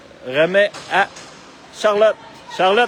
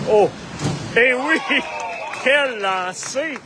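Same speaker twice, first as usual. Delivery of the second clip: excited goal call; 7 semitones higher, slower than usual. Real, not acted. Goal scored, commentator excited.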